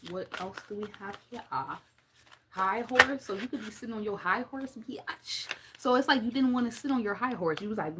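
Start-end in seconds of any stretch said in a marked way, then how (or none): 4.9-5.5 whispered "bitch"; playful whisper; whispered aside. Real, not acted. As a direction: breathe it as a teasing aside. Teasing aside.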